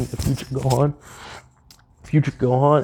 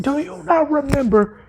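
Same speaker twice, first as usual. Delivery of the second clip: much higher mock yell. Gohan yells at Vegeta, much higher. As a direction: throw it at him hard. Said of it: "Gohan's yelling at this point".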